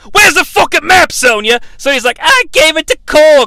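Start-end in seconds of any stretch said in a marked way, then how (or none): 0.1-1.6 high, loud mock-Kano yelling; exaggerated exasperated yell; retold angry shout. Acts out Kano's meltdown in desert. 2.2-3.5 high, loud retort in a squabble; imitated argument, raised voice; mock yelling. Acts Sonya arguing back in meltdown.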